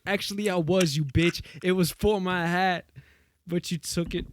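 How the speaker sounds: high, loud wronged rapper voice; mock angry rapper. Rapper scolds the woman who took his hat, high and loud. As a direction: rant high at the hat thief, petty and hurt. Acting out Pete's rap at a thief.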